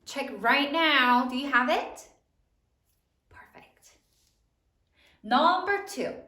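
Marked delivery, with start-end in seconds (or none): 3.3-3.9 whispered praise. Real, not acted. Teacher praising class quietly.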